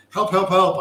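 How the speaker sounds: storyteller's urgent help cry; mock cry for help. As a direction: call out as a scorpion begging rescue. Scorpion cries for help in fable.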